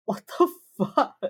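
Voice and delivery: high, loud, aghast swearing; acted disbelief. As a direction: call after him in outraged disbelief. Acted colleague aghast as Reed leaves.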